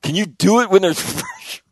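loud, high mock taunt with a laugh; teasing dad. Dad taunts sons on the ladder, loud laughing tease. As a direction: goad them like a prankster father. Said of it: "I'm like".

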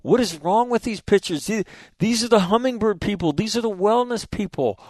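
high-pitched, hushed mock disbelief; exasperated disbelief. At lunch with yoga instructors, high mock bafflement. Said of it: "And I'm like".

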